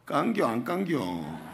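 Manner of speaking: slow, drawling Chungcheong punchline; joking Chungcheong accent, stretched out; Chungcheong dialect. Retold or imitated. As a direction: take your time like a Chungcheong local. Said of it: "이 충청도 말로 뭐라는 줄 아세요?".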